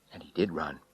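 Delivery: usual speaking voice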